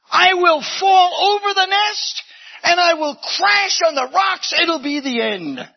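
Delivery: high, loud panicked shout; fearful cry. Acted. Bird voicing its dread of crashing, loud and high. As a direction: cry out in alarm.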